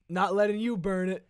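loud, high shouted catchphrase; S1 booms the villain's catchphrase. Acted. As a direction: belt it out like a cartoon villain. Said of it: "what was my catchphrase?".

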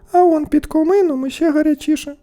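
very high, loud princess voice; high-pitched, cutting reply; princess's retort. A narrator's reading. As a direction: top the suitor's remark.

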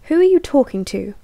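loud, high, rapid interrogating shout; angry demand. Acted. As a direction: demand an answer, furious and loud. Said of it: "one of them demanded".

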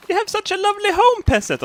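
very high, fast sneering aristocrat; snooty noble voice, high and quick; mock posh lord. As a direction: patronise the peasant in a silly high voice. Spider mocking the peasant.

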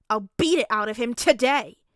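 loud, hot-headed declaration; fierce vow. A narrator's reading. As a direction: promise a beating at full force. Child vows to beat up classmate.

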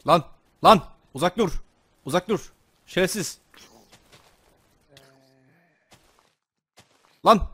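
high, sharp cries at an attacker; short panicked outbursts in a game; alarmed yelling. Real, not acted. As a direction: yell a startled warning to back off.

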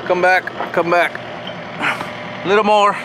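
normal talking voice

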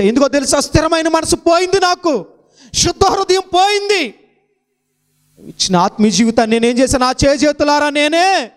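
high, loud climactic preaching; preaching at full force. Acted. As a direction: build the sermon to a ringing peak. (ఉద్రేకంతో బోధించే స్వరం)

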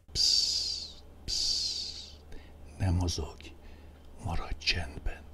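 soft unvoiced whisper, shushing; whispered shh, keep still; hushing whisper. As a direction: hush them like a spell. Hypnotic whispered hush refrain.

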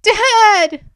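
very high, loud cry; anguished shriek. A narrator's reading. Girl seeing her father in a vision, anguished shriek. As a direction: shriek it in agony. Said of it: "Ren shrieked".

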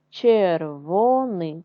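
червоний drawn out slowly syllable by syllable; slow syllable reading. Shown as a demonstration. Teacher showing first graders how to read червоний, slow. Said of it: "читаємо з вами слова".

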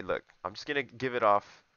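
ordinary talk

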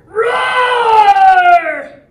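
high, loud, drawn-out roar; full dinosaur roar. Acted. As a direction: let rip like a dinosaur. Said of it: "gonna be lots of roaring".

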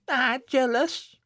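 high, slow, loud indignation; indignant cry. A narrator's reading. Jennet stung by an accusation, indignant cry. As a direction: throw it back, stung. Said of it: "cried Jennet, reddening".

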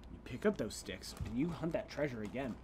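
deep, very soft commanding tone; low stern voice. Acted. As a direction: growl it low like a stern coach. Stern command to the other player.

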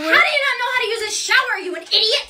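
fast, high-pitched outburst; acted shrieky impatience; exasperated scolding. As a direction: berate them in hammed-up disbelief. Host berates guest about shower.

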